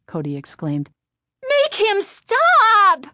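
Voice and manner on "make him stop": very high, loud, slow scream. A narrator's reading. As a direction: scream at her sister for help. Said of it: "Chris screamed at her sister".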